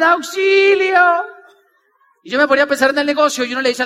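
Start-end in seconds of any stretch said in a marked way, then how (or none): 0.0-1.2 high, loud, drawn-out call; mock cry for help. Rider stuck again halfway along the cable, mock cry for help. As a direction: act a stranded rider calling out.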